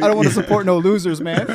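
high, laughing through it; quoting his friend through laughter; laughing quote. Retold or imitated. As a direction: say it while cracking up. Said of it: "He's like".

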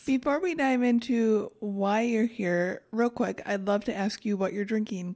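usual speaking voice

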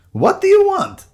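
very high, loud outburst; startled protest. Acted. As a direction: yelp the question in alarm. Startled damp man, beer spilt.

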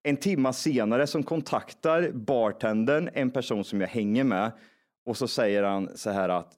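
usual speaking voice

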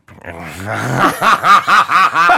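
Turkey Teeth cackle. Shown as a demonstration. Asked how he gets into Turkey, he lets rip a cackle. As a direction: burst into the character's manic laugh. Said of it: "how'd you get into Turkey".

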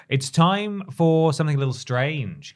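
dramatic announcer. Acted. Dramatic show segment intro.

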